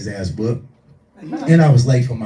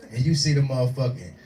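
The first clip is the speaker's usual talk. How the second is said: normal talking voice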